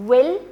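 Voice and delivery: will punched loud and rising; stress on will. Shown as a demonstration. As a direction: hit will hard as the rule's key word. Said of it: "simple future का यही rule है". (will पर ज़ोर, ऊँचा और तेज़)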